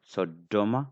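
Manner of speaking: Sodoma with a firm doubled d; strong sound. Shown as a demonstration. Teacher asking learners which sound is strong, doubled d. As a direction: hold the d in Sodoma, then release. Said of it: "da is strong".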